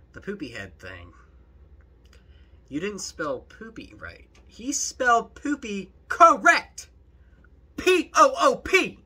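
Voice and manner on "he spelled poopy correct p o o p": loud, high, emphatic spelling out; exasperated correction. Real, not acted. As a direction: hammer home each letter loudly.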